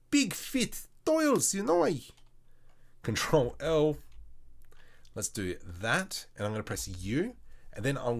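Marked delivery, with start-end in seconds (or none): 0.1-1.9 far higher, louder cartoon squeak; squeaky silly voice. Repeating his tile wish to viewers in a louder, very high squeak. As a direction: squeal Big fit tiles like a cartoon.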